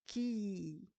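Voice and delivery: "ki" with the i held long; sustained, drawn-out "ki"; long vowel. Shown as a demonstration. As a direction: stretch out the i in "ki". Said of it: "Avec les longues voyelles".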